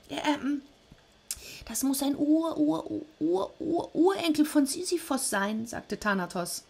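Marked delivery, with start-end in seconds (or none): 0.1-5.7 high, stammering, nervous voice; timid Death. Acted. Death nervously answering angry Zeus, high stammer. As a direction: stutter the great-greats sheepishly.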